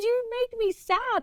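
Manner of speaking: very high, slow retold complaint; plaintive appeal. Agent tells client how he makes her feel, acted hurt. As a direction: draw it out high, acting hurt.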